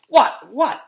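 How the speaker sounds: high, loud, eager double question; can't wait to hear the answer; impatient. A narrator's reading. Bully Terrence pressing the other kids to tell him.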